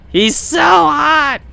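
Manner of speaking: slow drawn-out swooning shout; excited anime fan. Acted. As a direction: stretch it out, melting over him. Repeats the squeal slower.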